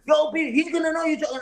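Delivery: a high, lightly voiced woman panicking on the phone; imitating her on the phone. Retelling how she called him frantic about the chat, high mock panic.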